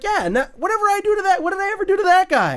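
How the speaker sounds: high-pitched, hurried protest, acted out; mock indignant retort. Norton's character defends himself to his friend, high mock protest. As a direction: play the troublemaker protesting his innocence. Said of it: "He's like".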